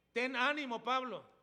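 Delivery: booming voiced Jesus; loud proclaimed quote. Preacher voicing Jesus, raised.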